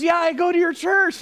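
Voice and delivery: high-pitched imitation of a lady; eager churchgoer. Sample lady explaining to pastor, high and eager. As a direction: lightly voice her, pitched well up.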